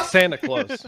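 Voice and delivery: high-pitched, laughing answer. Real, not acted. Teasing a friend about his Kris Kringle beard, laughing. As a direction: squeeze it out through real laughter.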